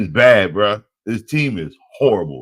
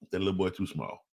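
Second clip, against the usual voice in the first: ordinary delivery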